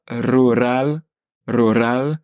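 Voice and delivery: "rural" first r strong, second light; rolled r. Shown as a demonstration. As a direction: roll the first r of "rural" hard. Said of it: "c'est le premier qui est plus fort".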